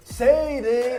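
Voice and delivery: put-on loud, high accented Sadie; copying the coach's Sadie with a thick accent; imitating coach's accent. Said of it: "you have to say it the way he said it".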